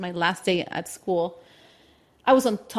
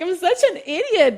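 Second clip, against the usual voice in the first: self-mocking; 11 semitones higher, 6 dB louder than usual. Real, not acted. Laughing at her past self.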